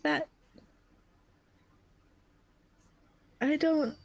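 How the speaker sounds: high and grieving, trailing off to nothing; drifting into exhausted sleep mid-sentence; fading voice. Acted. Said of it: "Edwell's voice kinda start to fade".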